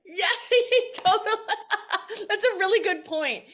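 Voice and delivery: high, loud laughing agreement; real laughter. Real, not acted. Comedian cracks up at the interviewer's joke, laughing high and loud. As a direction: laugh through the words, delighted.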